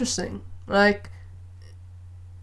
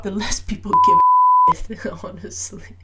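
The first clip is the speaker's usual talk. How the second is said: laughing whisper; 6 dB louder than usual. Real, not acted. Laughing aside about caring less.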